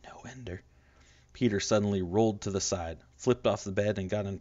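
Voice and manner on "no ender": soft pleading whisper. Acted. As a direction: hush him before he strikes. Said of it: "Valentine whispered".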